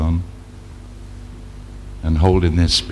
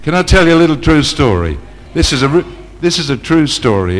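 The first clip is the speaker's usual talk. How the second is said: eager; 7 semitones higher, 3 dB louder than usual. Acted. Repeats question louder, higher.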